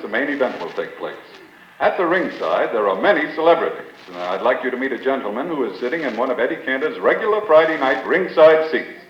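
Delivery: ordinary talk, nothing marked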